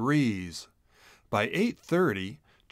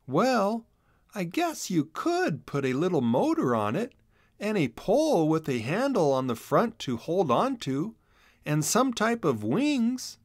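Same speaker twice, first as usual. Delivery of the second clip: put-on higher, musing kid voice, slowing at the end; a hint of a boy working it out; thinking aloud; 7 semitones higher than usual. A narrator's reading. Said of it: "he started thinking".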